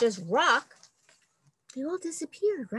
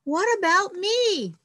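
high, lonely calling out; 10 semitones higher, slower than usual. Acted. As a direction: call out high, hoping someone answers. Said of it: "called Carl".

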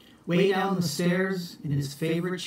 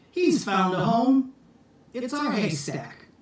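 happy announcement; 7 semitones higher than usual. Acted. Jack telling the family they keep the kitten, cheerful. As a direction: announce it gladly, lifted.

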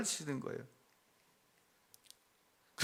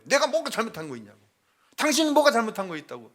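fast, louder, replaying the snap at his wife; angry retort; 7 semitones higher, 4 dB louder, faster than usual. Husband reliving angry words at his wife, fast and heated. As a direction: fire it back fast and heated, reliving the fight. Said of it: "그럼 제가 막 또 화를 내는 거예요".

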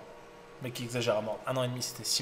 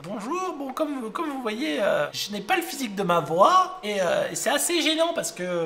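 put-on girly voice; 9 semitones higher than usual. Commander explaining his problem to the doctor, high girlish voice. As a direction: speak up timidly in a little girl's voice. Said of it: "il a une voix de meuf".

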